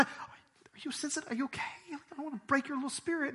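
high, soft mocking coddling voice; mock gentle. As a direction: sneer at the coddling, breathy and soft. Mocking overly gentle speaker.

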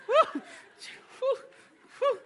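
high loud whoop; excited whoop. Real, not acted. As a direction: let out a loud woo.

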